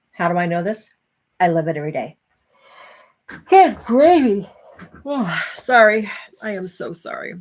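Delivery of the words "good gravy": slow, loud words stretched by a yawn; said through a yawn. Real, not acted. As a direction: talk right through a big yawn. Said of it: "especially about me yawning".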